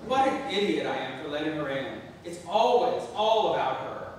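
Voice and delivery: loud, exasperated rant; angry self-scolding. Acted. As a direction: rant at yourself loudly. Self-reproach.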